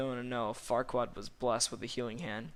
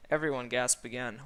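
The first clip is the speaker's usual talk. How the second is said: ordinary delivery, nothing marked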